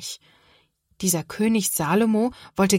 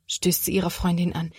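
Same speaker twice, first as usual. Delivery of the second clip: ordinary delivery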